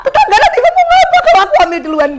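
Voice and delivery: very high, loud comic despair; panicked wail. Retold or imitated. Pregnant daughter dreads neighbours' talk, panicked wail. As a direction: cry out in exaggerated panic.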